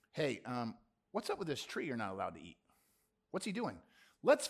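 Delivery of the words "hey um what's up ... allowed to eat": softer, low sly tempter; sly serpent. Acted. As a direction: slip the question in quietly like a tempter. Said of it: "What's he say?".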